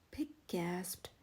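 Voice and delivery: usual speaking voice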